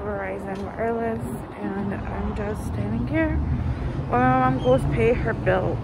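muffled by cloth mask; speaking behind fabric covering; through a face mask. Real, not acted. Seen 0: Patterned cloth mask covers her mouth and nose throughout the labelled words.

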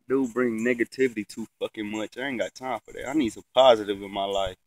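ordinary delivery

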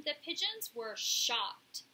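ordinary delivery

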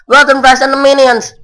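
real excitement bursting out; excited shout. Real, not acted. Excited by game characters.

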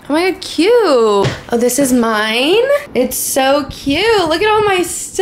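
high, loud delighted gushing; excited. Real, not acted. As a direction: gush over the gifts. Excited seeing gifted room.

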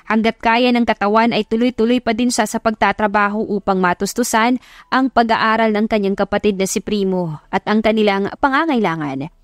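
usual speaking voice

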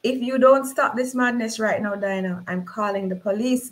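ordinary delivery, nothing marked

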